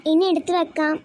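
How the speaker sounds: normal talking voice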